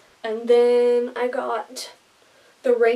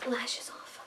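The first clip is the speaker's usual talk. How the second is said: whispering; 13 dB quieter than usual. Real, not acted. Removing fake lashes, whispering.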